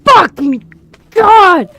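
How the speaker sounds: loud, slow, drawn-out cry at the sky; anguished curse. Real, not acted. Player cursing the game, loud and slow in disbelief. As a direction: wail it out in disbelief.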